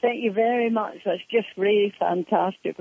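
lightly acted high-pitched thanks; mock-delighted reply, high then easing down; retold grateful thanks. Acts out her thanks to the lady.

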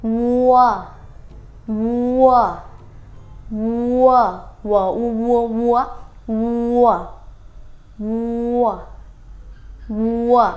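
"vua" called out loud and clear, a level tone each time; loud word drill. Shown as a demonstration. Teacher drilling the Thai word for cow, "vua", loudly. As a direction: belt out "vua" like modelling it for a class. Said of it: "bò là".

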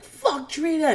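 a hint of a yell, not full; mock scream. [name] standing and banging the table, mock yelling. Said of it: "she's screaming".

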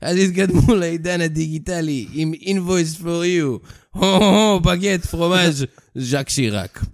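Hebrew ad read in a French accent. Shown as a demonstration. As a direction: ham up the Frenchman, bagette and all. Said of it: "נא לקרוא במבטא צרפתי".

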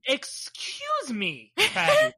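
drawn-out, indignant excuse me; high-pitched playful outrage, very slow; mock offended. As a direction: feign affront, stretching it out. Mock offence at name called boring.

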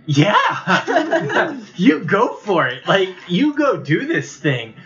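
high, excited little sister voice; whiny tattling little sibling. Acted. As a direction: play the eager kid sister. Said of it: "I like the little sister impression".

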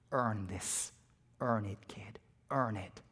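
hushed, breathy last words, barely voiced; dying whisper. A dying captain whispers to the young soldier. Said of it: "this is what he says".

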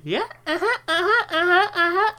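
high, laughing uh-huhs brushing him off; mocking dismissive nurse. Nurse humoring groggy patient's rambling, in a high mocking voice. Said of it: "And she said".

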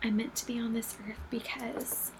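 hushed whisper. Real, not acted. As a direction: whisper it close to the mic. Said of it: "whispered aside".

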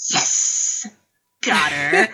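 gloating under the breath; smug victory hiss to himself; smug whisper. Retold or imitated. Darcy savouring his win, smug under-breath whisper. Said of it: "a little bit of a smug smile".

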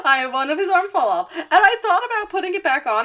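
high, loud, laughing retelling; words squeaking through real laughter; laughing while talking. Real, not acted. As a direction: crack up while telling it. Cracking up at own story.

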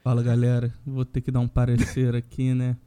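low, monotone, deadpan announcement; put-on solemn voice, pitched low and level; mock-solemn statement. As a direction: deliver a grave official statement, tongue in cheek. Mock-solemn statement on his absence.

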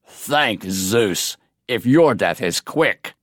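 low, scornful giant voice; mocking giant. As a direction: sneer it down at the prisoners. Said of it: "Decimus mocked".

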